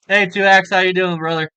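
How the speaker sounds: normal talking voice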